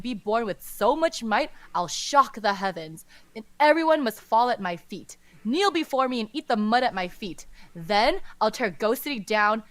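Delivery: loud, boastful villain rant; booming echoing villain monologue. Acted. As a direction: rant like a pompous ghost king. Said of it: "Qirong says".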